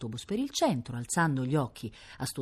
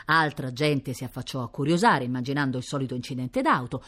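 usual speaking voice

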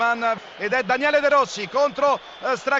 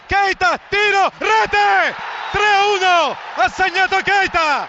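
slow, stretched goal cry; goal shout; 4 semitones higher than usual. Real, not acted. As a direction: build to the shot, then shout. Lazio third goal call. (urlo del gol, lento e allungato)

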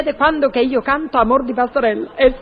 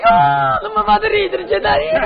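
high laughing voice; 8 semitones higher than usual. Acted. Woman laughs at the question about comedy.

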